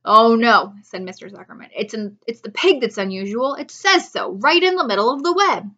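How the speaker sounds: loud insistent farmer. Acted. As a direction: insist on the pig. Zuckerman insisting.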